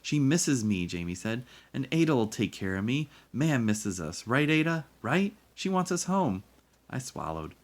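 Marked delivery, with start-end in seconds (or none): 0.0-0.9 pleading. A narrator's reading. Boy pleads to go home. 1.7-6.4 put-on high, loud child's plea; pleading. A narrator's reading. Boy begs sister to back him up, pleading.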